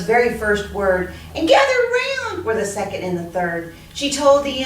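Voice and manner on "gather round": high, loud call to gather. Acted. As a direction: summon everyone over. Ladybug calls the animals.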